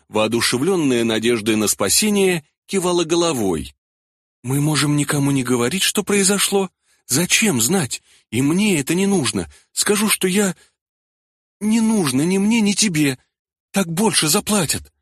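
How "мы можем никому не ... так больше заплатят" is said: high, eager, pleading woman; persuading woman's voice. A narrator's reading. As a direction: coax him, voice raised and quick. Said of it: "убеждала Гурана Рахиль".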